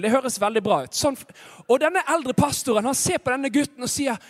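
ordinary delivery

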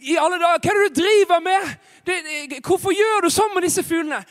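high, loud, outraged protest; indignant scolding. Acted. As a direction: scold the boy in shocked disbelief. Pastor confronts cruel boy. (opprørt, høylytt irettesettelse)

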